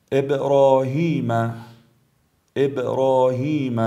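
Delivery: slow ابراهیم with a bounced ب; brief qalqala. Shown as a demonstration. Tajweed teacher shows letter ب, bouncing it in ابراهیم. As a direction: release the ب of ابراهیم briefly, long vowels drawn out. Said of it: "حرف با".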